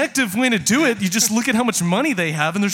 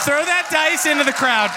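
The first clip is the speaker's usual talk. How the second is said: shouting; 9 semitones higher than usual. Real, not acted. Excited shouting at the table.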